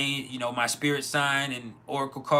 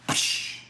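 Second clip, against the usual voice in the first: breathy psh for the crash; pipe drop noise. Retold or imitated. Telling friends how his pipe fell, breathy crash noise. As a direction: mimic a glass pipe hitting the floor.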